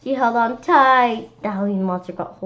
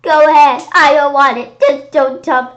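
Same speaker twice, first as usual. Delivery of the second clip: pleading; 6 semitones higher, 10 dB louder than usual. Acted. Kid begging monster.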